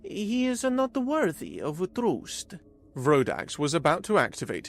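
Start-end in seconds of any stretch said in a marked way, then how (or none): 0.0-2.6 higher, slow, measured alien voice; calm alien elder. A narrator's reading. Zira calmly refusing Vrodax's plea, slow alien voice. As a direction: state it calmly, unmoved. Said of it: "Zira said calmly".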